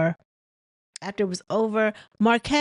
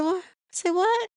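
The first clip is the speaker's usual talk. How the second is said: retold shocked what; 11 semitones higher than usual. Melody's shocked reply.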